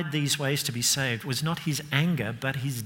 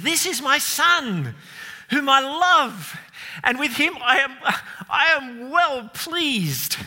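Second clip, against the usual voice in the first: much higher and louder, slow, proud proclamation; God the Father's booming voice; 11 semitones higher, 5 dB louder, slower than usual. Acted. Preacher voicing the Father shouting from heaven, proud and booming. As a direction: call down from heaven bursting with pride. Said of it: "he audibly shouts out".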